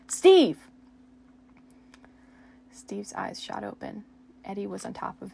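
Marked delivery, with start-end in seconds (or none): 0.1-0.7 very loud high call of the name; sharp shout. Acted. Eddie waking Steve from his nightmare, loud high shout.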